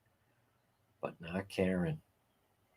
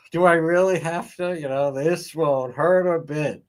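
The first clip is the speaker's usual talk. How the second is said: mock dialogue; 4 semitones higher, 3 dB louder than usual. Acting out the bangs haircut scene.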